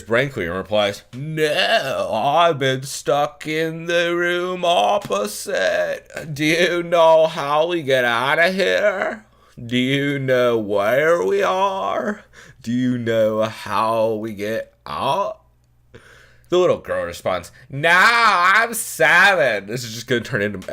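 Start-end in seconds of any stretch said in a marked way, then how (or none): 1.1-15.4 much higher, slow put-on voice for Mitch; really high Mitch. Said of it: "make him sound really high every time he talks". 17.7-19.7 high, loud, slow put-on Fran voice; the little girl snaps back in the Fran voice; Fran-style girl voice. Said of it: "she is going to sound like Fran from The Nanny".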